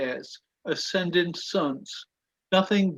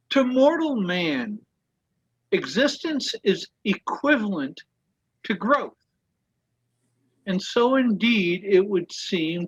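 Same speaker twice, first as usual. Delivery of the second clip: slightly raised, measured reading voice; reading aloud; 4 semitones higher than usual. Acted. [name] reading a quote to the study group, measured and deliberate.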